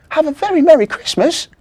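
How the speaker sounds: very high, loud festive wish; cheery elf. Acted. Elf Marzi wishes Timmy a merry Christmas, high cheerful. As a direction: sing it out merrily.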